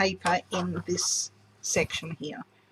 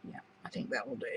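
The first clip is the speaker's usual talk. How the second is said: whispering; 8 semitones lower, 14 dB quieter, much faster than usual. Real, not acted. Muttering to herself while crafting.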